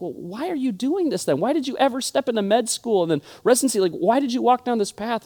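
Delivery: retold disbelief, pitched well up; high-pitched, baffled why; incredulous questioning. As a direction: question a friend's choice in acted bewilderment. Reacting to friend's confession, retold.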